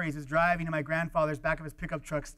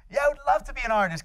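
higher, louder joyful cry; thrilled; 8 semitones higher, 8 dB louder than usual. Retold or imitated. Teen thrilled to hear he could be an artist. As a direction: burst out with delight. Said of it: "And I go".

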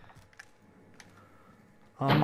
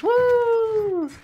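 excited whoop. Real, not acted. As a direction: let out a triumphant woo. Celebrating the kill.

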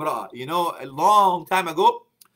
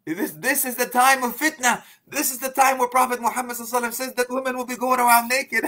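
preacher quoting an imam's alarmed lament, in a higher voice; 6 semitones higher than usual. Retold or imitated. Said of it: "He says".

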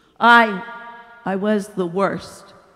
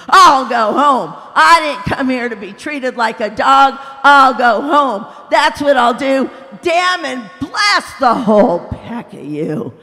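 loud, higher stubborn shouting; furious threat; 4 semitones higher, 9 dB louder than usual. A narrator's reading. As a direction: stamp off threatening. Ghost threatens to leave.

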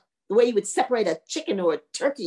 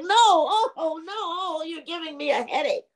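pained ghost complaint; 6 semitones higher than usual. Acted. Ghost whose skull is being drummed, high pained moaning. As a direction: moan in misery, pitched up.

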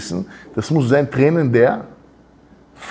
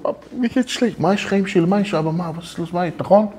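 put-on much higher, softer pleading kid to his dad; whiny child; 6 semitones higher, 4 dB quieter than usual. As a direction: beg your papa like a sad little child. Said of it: "Wenn zu deinem Papa kommst". (quengelndes Kind, hoch und leise)

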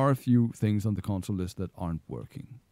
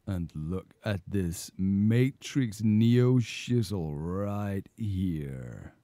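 slow-motion drawl; much slower than usual. Shown as a demonstration.

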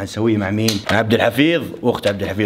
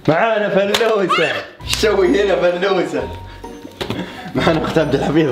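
excited discovery; 6 semitones higher than usual. Real, not acted. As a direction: gloat over finding her. Found a hider, excited.

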